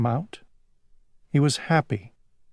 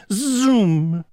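whooshing zoom; 8 semitones higher, 3 dB louder than usual. Acted. Dragonfly flying past sound.

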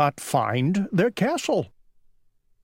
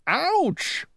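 high acted yelp; pained cry. A narrator's reading. Tired old king cries out, high pained yelp. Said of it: "cried the king".

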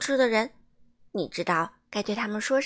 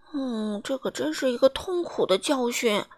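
usual speaking voice

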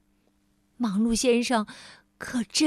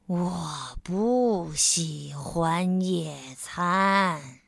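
lower, very slow grumble; slow complaint; 6 semitones lower, much slower than usual. Acted. Mr. Slow complaining to Mr. Busy, low and very slow. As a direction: drag out a sulky protest. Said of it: "他抱怨说".

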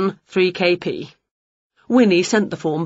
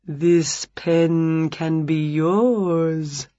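smarmy vampire; 2 semitones lower, much slower than usual. A narrator's reading. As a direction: purr it out like a smooth salesman vampire. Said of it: "A smarmy vampire".

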